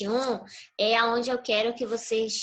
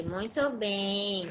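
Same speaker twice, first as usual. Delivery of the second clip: slow and softer, gently pleased; soft slow praise; 2 semitones lower, 5 dB quieter, much slower than usual. Real, not acted. Teacher praising a child's correct answer, soft and slow. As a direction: let the praise settle softly.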